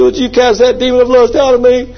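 high-pitched, whiny plea for deliverance; hammed-up desperate supplicant; mock pleading. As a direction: beg in a high, put-on voice. Mimics man begging for deliverance.

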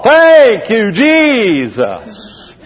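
big, drawn-out shout of thanks; every word highlighted. Shown as a demonstration. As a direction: THANK YOU JESUS all punched high and loud. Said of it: "You just highlight them all".

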